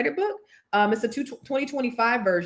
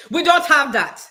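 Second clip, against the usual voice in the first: rude Caribbean server; 6 semitones higher, 6 dB louder than usual. Retold or imitated. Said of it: "So they say".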